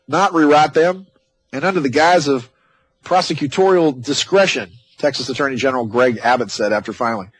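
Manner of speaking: usual speaking voice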